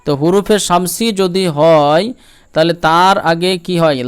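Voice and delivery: normal talking voice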